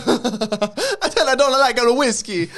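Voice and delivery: son boasting about weed in mock Jamaican accent. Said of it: "that Jamaican accent".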